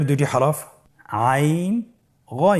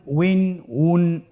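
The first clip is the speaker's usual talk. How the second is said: উইন, উন drawn out very slowly; slow, deliberate উইন উন; slow drill; much slower than usual. Shown as a demonstration. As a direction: stretch উইন and উন for learners to copy. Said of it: "আমার সাথে বলুন".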